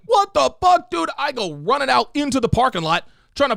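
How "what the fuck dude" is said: high, loud angry yell at the news; furious yell. Retold or imitated. Boyfriend yelling in outrage over his girlfriend. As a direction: let it burst out in outrage. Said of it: "I was like".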